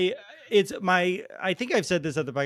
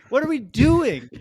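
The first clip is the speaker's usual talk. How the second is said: higher, exasperated complaint; 8 semitones higher, 3 dB louder than usual. Pretend. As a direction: throw up your hands. Mock exasperation at low standards.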